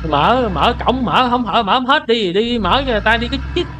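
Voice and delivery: high, agitated nagging; exasperated urging. Real, not acted. Player nagging a gate that will not open fully, exasperated.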